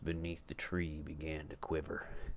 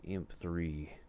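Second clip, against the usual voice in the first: ordinary delivery, nothing marked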